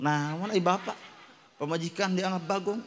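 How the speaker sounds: ordinary talk, nothing marked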